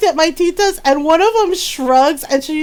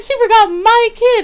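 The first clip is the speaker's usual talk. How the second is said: much higher, aggrieved mock protest; imitated indignation; 8 semitones higher, 3 dB louder than usual. Brother protests his own child was forgotten, mock indignation. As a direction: protest like a wronged parent, pitched up.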